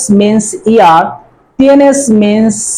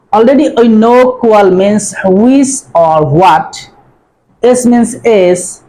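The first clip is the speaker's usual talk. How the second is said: usual speaking voice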